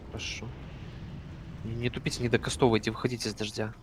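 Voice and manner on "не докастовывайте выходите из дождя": ordinary delivery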